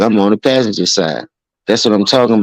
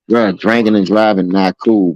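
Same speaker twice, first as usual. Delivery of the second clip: ordinary delivery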